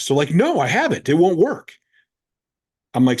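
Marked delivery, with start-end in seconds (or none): 0.3-1.7 higher-pitched imitation of a stubborn lifter; mock a defensive, dismissive refusal. Lifter refusing coach's advice to train less, stubborn.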